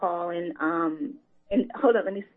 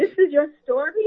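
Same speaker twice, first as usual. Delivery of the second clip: astonished; 8 semitones higher, 7 dB louder than usual. Retold or imitated. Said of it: "I was like".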